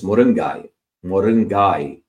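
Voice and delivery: moringai in a Tamil accent, ending in -gai; Tamil-accented moringai with a falling end. Shown as a demonstration. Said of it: "if you put a Tamil accent".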